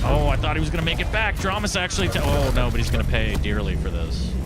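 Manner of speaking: loud, high hype call; excited. Real, not acted. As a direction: shout the play as it happens. Caster reacts to a play.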